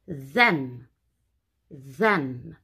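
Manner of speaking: THEM low, with a long voiced th; modelled pronunciation. Shown as a demonstration. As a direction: draw out THEM low for learners. Teacher models a new word.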